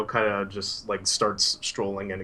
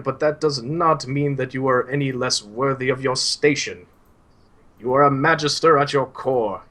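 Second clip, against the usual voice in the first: put-on exasperated old Suhail; 3 semitones higher, 6 dB louder than usual. Old teacher rebuking his doubting student, building louder. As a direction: scold the boy kindly, building up. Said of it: "he kinda rolls his eyes and he's like".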